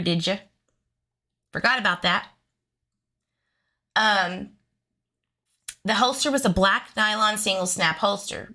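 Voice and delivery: ordinary talk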